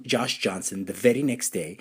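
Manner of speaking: usual speaking voice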